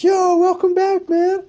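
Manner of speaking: high, loud guys' greeting; mock bro greeting. Buddies greet him back at the hangar, high and loud. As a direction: hype dude calling out welcome.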